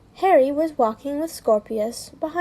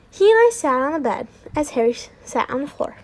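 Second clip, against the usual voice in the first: plain reading voice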